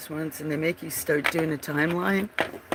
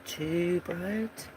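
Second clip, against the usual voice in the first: ordinary talk, nothing marked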